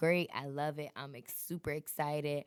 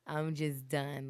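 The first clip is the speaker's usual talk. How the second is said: usual speaking voice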